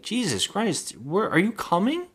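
high, exasperated demand; exasperated boyfriend. Retold or imitated. Boyfriend scolding her on the phone, high and exasperated. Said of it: "He's like".

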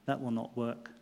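soft, low-volume judge's reply; said quietly. A narrator's reading. The judge quietly refuses the defendant's plea, softly. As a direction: speak it gently and quietly. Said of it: "He said quietly".

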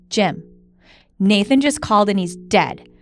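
loud fake alarm call; mock bad news. Wife pretending to report son's death, loud. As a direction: announce it loudly, deadpan. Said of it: "I'm gonna be like".

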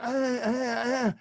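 high strained grunts through a throat tube; desperate grunting. Retold or imitated. Patient on a ventilator grunting urgently at the doctor.

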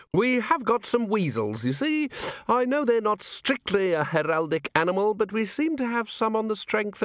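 put-on high, rambling old official; fussy old herald. Herald trying to place some weasels, high fussy voice. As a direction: prattle on high, easing down as you go.

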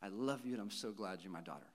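put-on tender murmur to a child at bedtime; imitated bedtime whisper. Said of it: "I whisper into their ears".

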